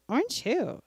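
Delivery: high-pitched mock testy question; irritated joke responder. Listener finally hearing a new word in the joke, mock irritated. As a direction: play the worn-down listener, voice swooping up. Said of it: "they're getting really irritated with you".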